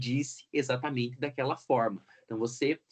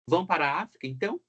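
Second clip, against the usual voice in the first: rising question, ENTÃO lifted high at the end; question tune; 3 semitones higher, much faster than usual. Shown as a demonstration. Teacher models a question's rise for students, rising tune. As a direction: ask it, pitch climbing on "então". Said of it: "essa tonicidade de interrogação".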